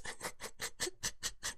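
breathless, voiceless wheezing laugh; helpless laughter. A narrator's reading. Man cracking up at his droid's new voice, wheezing. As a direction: laugh until the air runs out. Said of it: "Bodhi's laughter redoubled".

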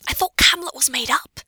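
high, partly whispered hiss. A narrator's reading. Hermione to Pansy once they've gained some distance, hissed. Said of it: "Hermione hisses when they've gained".

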